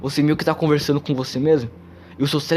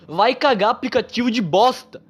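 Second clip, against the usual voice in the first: real irritation at the app, raised pitch; fed-up outburst at the recording app; annoyed cursing; 7 semitones higher than usual. Real, not acted. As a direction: swear at the app that keeps cutting you off. App keeps cancelling the recording.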